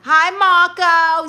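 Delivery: loud, high, drawn-out hello; excited greeting. Real, not acted. Streamer greets Marco as he joins, excited greeting. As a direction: call out slowly and high to welcome someone. Greets a viewer arriving in chat.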